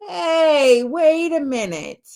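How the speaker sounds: sudden high "Hey, wait a minute"; dawning surprise. Retold or imitated. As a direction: realise you've been had, loudly. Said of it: "you're like".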